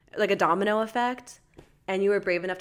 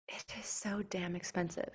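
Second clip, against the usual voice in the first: breathy whisper, much softer, no voice at all; whispered aside; 10 dB quieter than usual. Real, not acted. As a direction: lean in and whisper the complaint. Aside about therapy costs.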